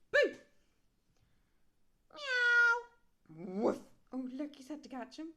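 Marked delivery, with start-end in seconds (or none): 2.2-3.6 very high, loud meow; cat meow. Pretend. Reader yowls as the cat, very high and loud. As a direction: yowl like a scared cat.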